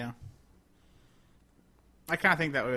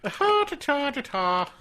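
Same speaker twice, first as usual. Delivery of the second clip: high silly voice; 10 semitones higher, 6 dB louder than usual. Pretend. Mock high tune.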